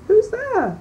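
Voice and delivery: high, lightly voiced old grandmother; old grandmother calling from bed; grandmother's voice. A narrator's reading. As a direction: call out like a frail old granny. Grandmother answers the door.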